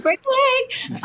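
high, slow motherly send-off; mom voice. Retold or imitated. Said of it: "She said".

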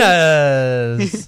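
loud, drawn-out announcer shout of "Abrines"; "Abrines" as an arena intro with a heavy trill; hard-rolled R announcer. Retold or imitated. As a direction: roll the R in "Abrines" really hard, stretched out. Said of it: "announcer now rolls his R's, like, really hard".

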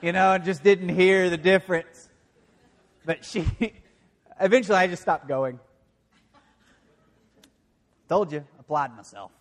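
real laughter at his own failure; laughing. Real, not acted. Preacher laughing to the congregation about quitting class, laughing.

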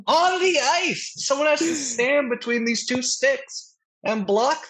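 high, slow, drawn-out mock pleading; exasperated fan rant. Fan telling the team to find a goalie, exasperated. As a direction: spell it out to clueless management.

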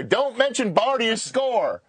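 high, slow mock Southern scream; Southern director yelling into the host's earpiece, mock scream. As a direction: imitate the director yelling in a twang. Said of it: "this Southern twang screaming in my ear".

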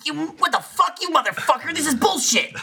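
high-pitched, sputtering indignant impression; Bernie. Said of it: "do a little bit of Bernie".

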